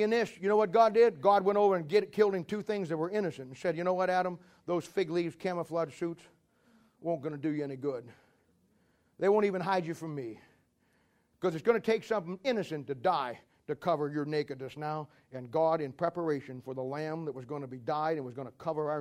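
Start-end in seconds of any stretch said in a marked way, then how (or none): 3.7-15.1 softer, lower, gentle fatherly words; tender God. Retold or imitated. God gently telling Adam the fig leaves fail, soft and tender. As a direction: speak gently to a child who erred.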